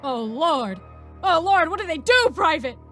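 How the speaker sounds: loud, high panicked wail; terrified holler. Acted. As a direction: holler in comic terror. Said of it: "he hollered, pointing in terror".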